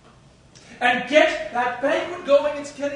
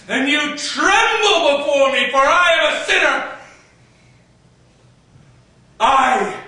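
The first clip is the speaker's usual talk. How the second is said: loud ranting building to higher shouts; mad king's rant; 3 semitones higher, 5 dB louder than usual. Acted.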